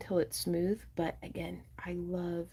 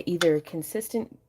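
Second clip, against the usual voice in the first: ordinary talk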